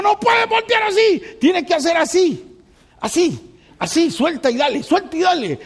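playful shouted driving lesson; shouting instructor. Retold or imitated. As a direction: yell driving orders like an impatient teacher. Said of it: "Y yo le digo".

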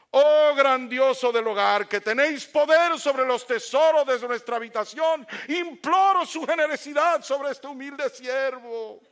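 loud, high, slow grandiose proclamation; pompous petition. Acted. Son asking dad for money, absurdly grandiose and loud. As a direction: implore like a herald before a throne, drawing words out. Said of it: "pedirle dinero de esa manera".

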